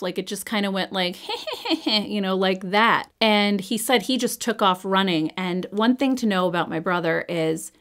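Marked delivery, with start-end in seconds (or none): 1.2-1.8 short breathy imitated evil laugh, higher and softer. Brother heard it by the woods, an imitated evil laugh. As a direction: give a quick mock evil laugh from the woods. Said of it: "this evil sounding laugh".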